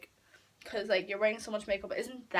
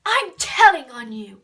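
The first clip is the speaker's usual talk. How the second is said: slow, very loud mock yell; calling after someone; 3 semitones higher, 15 dB louder than usual. Fifteen-year-old Jane calls after her, mock tattling yell. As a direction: imitate a tattletale shouting down the street. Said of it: "she calls after me".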